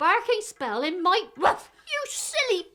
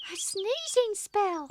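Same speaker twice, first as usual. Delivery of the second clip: ordinary delivery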